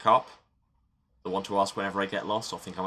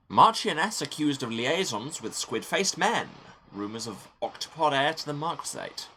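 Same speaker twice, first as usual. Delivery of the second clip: headline reader; 7 semitones higher than usual. Acted. Player reading out scandal headlines from the game, mock-proclaiming. Reads newspaper headlines aloud.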